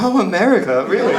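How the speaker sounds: high-pitched mock awe; impressed Poles. Poles reacting to an American, starstruck. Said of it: "here in Poland, they're like".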